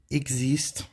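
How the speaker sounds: "existe" as eg-zist, rising at the end; French pronunciation demo. Shown as a demonstration. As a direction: model "existe" with X as GZ, lifting the tune. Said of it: "um G com S".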